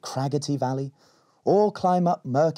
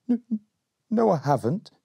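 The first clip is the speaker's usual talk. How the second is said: stammering denial; 10 semitones higher than usual. Acted. Berry denies nervously.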